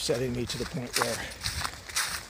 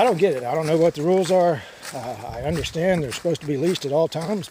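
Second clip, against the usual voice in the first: normal talking voice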